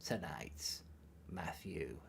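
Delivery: very soft, low, slow croak; hushed, drawn-out dark lord impression; Palpatine. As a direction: intone each phrase low with long pauses. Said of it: "I can do it like this".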